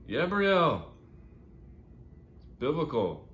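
loud, high repeat, easing slightly on the explanation; girl's insistent repeat. Acted. Girl repeats name to baffled rescuer.

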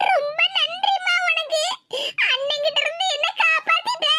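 put-on wheedling little-girl thanks; whiny gratitude. Daughter gushes thanks to her mother.

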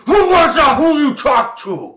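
high, loud goblin demand; suspicious goblin shout. Acted. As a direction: bark it like a startled goblin.

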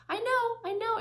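high, proud I know, I know; boasting like Monica. Retold or imitated. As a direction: show off that you already knew. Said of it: "자랑하듯이 얘기한".

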